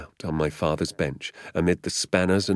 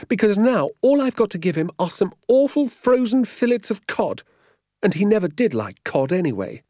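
very high, loud complaining woman; aggrieved vicar's housekeeper; 7 dB louder than usual. A narrator's reading. Woman answering sergeant about the vicar's disappointment, aggrieved. Voices Missus Clipstone.

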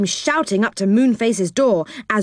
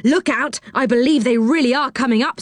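alarmed; 6 semitones higher than usual. A narrator's reading. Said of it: "said Joe in alarm".

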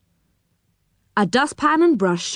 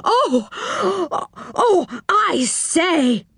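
high, slow, halting exclamation; excited stammering; 11 semitones higher, much slower than usual. Acted. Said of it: "stammering all the more".

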